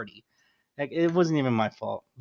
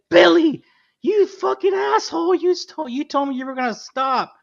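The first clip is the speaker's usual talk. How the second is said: high, loud mock yell at the boyfriend; a girlfriend screaming at her boyfriend at a party, high and loud; retold furious yell; 13 semitones higher, 5 dB louder than usual. Angry girlfriend yelling in story.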